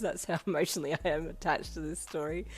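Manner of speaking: loud, laughing through tears; teary laugh. Real, not acted. Narrator admitting the chapter made her cry, laughing. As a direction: laugh off the emotion. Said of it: "my eyes were watering up".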